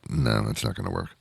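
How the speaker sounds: ordinary talk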